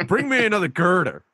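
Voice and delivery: very high shouty construction worker voice; hammed-up construction worker hollering to George; New York construction worker. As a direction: call up the girder like a New York site worker.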